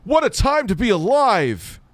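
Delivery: high, gushing fake wonder; overjoyed, sarcastic; mock amazed. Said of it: "you were still like".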